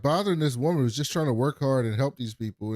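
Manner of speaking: usual speaking voice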